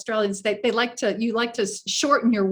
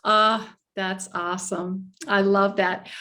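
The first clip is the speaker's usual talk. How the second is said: normal talking voice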